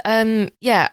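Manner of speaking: ordinary talk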